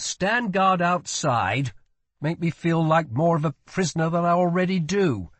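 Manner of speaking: high-pitched sarcastic orders; put-upon prince ordering servants out; irritated prince. A narrator's reading.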